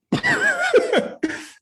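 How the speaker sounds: high, loud squealing laugh; burst of laughter. Real, not acted. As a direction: let a shrieking laugh burst out. Laughing at his own thought.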